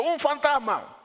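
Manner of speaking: high frightened cry of ghost; high scared shout of ghost. Retold or imitated. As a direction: cry out like scared disciples at night. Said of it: "se turbaron diciendo".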